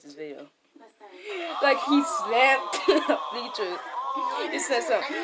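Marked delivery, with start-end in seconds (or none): 1.6-5.1 laughing while telling it; stifled giggles. Real, not acted. Laughing while recounting clip.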